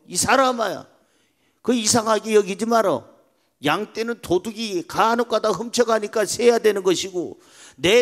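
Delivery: high, loud, folksy grandpa voice; old shepherd retorting. Acted. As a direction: act out an old shepherd justifying himself emphatically. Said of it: "할아버지가 하시는 말씀".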